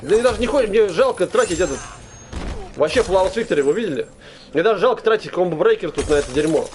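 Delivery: ordinary talk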